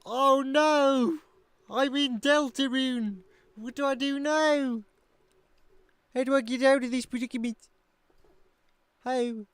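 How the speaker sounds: loud, high-pitched hammed-up dismay; mock panic. Streamer pretending to be trapped in Deltarune, mock panic.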